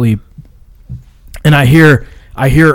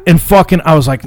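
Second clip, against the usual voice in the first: ordinary delivery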